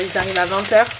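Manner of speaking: ordinary delivery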